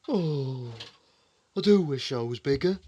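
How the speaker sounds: a sighing wish, put-on voice; sighed. A narrator's reading. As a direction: sigh it out wistfully. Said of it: "he sighed".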